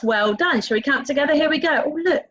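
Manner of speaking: ordinary talk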